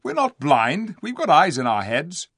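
high, loud protest; indignant. A narrator's reading. Eustace retorting to the dwarf, indignant. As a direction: object as if insulted. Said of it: "said Eustace indignantly".

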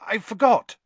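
high, loud stumbling apology; hasty apology. A narrator's reading. Boy explains his slip to conductor, hastily apologetic. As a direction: blurt it out, tripping on the words. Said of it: "said Harry hastily".

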